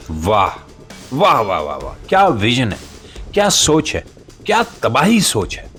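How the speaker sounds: normal talking voice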